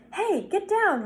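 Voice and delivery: high-pitched urgent order; urgent call. A narrator's reading. Boy ordering the cat off the pan, urgent call. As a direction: call out a quick, high warning.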